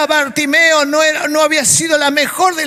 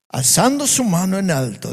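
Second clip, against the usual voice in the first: normal talking voice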